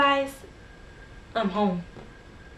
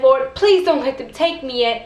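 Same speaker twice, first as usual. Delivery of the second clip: loud acted plea for his life; retold desperate scream; 2 semitones higher, 7 dB louder than usual. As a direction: cry out to the Lord, loudly but controlled. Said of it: "he would scream for his life".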